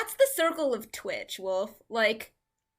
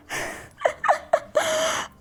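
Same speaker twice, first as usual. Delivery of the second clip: high laughing shriek; 5 dB louder than usual. Real, not acted.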